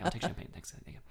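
unvoiced hushed thanks; whispered. Ashamed passenger thanking attendant, whispering. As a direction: whisper it so no one hears.

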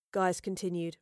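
ordinary delivery

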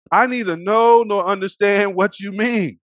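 loud, high-pitched declaimed denial; acted formal protest; quoted denial. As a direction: deny it emphatically, voicing the accused. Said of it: "He denied it".

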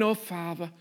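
low, hushed address to God; distant, formal prayer. Retold or imitated. Everybody else praying to a far-off God, low and subdued. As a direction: imitate a subdued, dutiful pray-er. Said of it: "Everybody else had been going".

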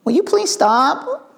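put-on high, drawn-out childish pleading; hammed-up child's whine; whiny kid. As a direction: beg like a pestering little kid. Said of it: "You know how kids do".

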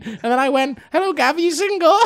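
very high, loud mocking posh-kid voice; whiny posh youth. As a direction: imitate an overexcited posh boy.

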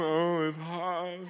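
mock moan.

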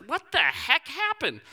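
high, drawn-out baffled reaction; mock disbelief. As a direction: stretch it out in exaggerated dismay. Acting out shock at bad haircut.

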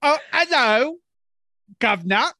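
high, loud hammed-up British accent; a cartoonish Cockney greeting, hammed up; mock British accent. A podcaster greeting her cohost in a hammed-up British accent. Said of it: "speak in British accents".

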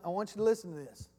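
usual speaking voice

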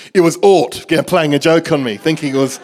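laughing loudly through the telling. Real, not acted. Speaker retelling friend's crocodile prank, loud and laughing. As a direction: tell it still cracking up at the prank.

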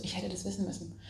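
barely audible, dropping the voice into self-reproach; quiet self-blame. Retold or imitated. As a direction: mutter it to yourself, defeated. Retold self-blame after mistake.

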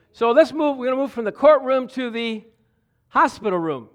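fervent Sunday preaching. Real, not acted. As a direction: lift the room with good news. Preaching full force.

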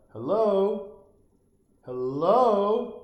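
loud, high call of hello. Acted. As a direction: shout hello at the screen like she can hear you. Calling to woman on screen.

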